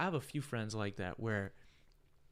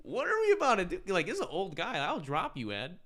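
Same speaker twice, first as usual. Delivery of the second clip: raised, higher-pitched challenge; retold confrontation; 9 semitones higher, 12 dB louder than usual. Bartender threatening to drop an old customer, loud mock-tough challenge. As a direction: square up loudly, pitch up, half in jest. Retelling standoff with angry customer.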